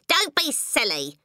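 high, drawn-out wifely scolding; impatient wife. A narrator's reading. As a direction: scold him as an exasperated wife. Wife scolding husband in story.

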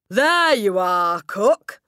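slow, very loud triumph; crying out. A narrator's reading. Headmistress showing cook the boy's praise, crying out. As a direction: cry it out triumphantly. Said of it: "the Trunchbull cried".

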